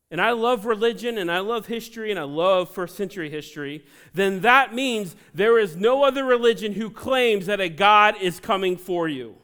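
loud, building pulpit cry; preacher rousing the congregation; full-force preaching. Real, not acted.

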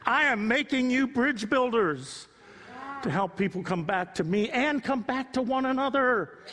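rally the congregation; fervent sermon voice; rousing preaching. Real, not acted. Preaching at full force.

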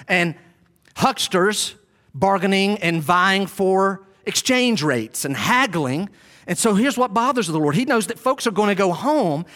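ordinary delivery

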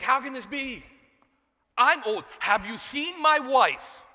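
loud, high, exasperated protest; hammed-up disbelief, drawing out the last question; incredulous Zechariah. Preacher as Zechariah doubting the angel, loudly incredulous. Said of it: "But he's like".

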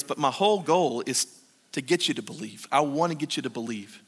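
soft, low and sincere; a quiet loving promise; soft, tender. Retold or imitated. Jesus voiced softly.

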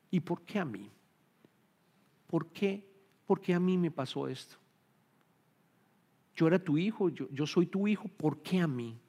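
softly acted lament with pauses; self-pitying complaint. Believer asking God why me, softly complaining. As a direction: lament quietly, as if wronged.

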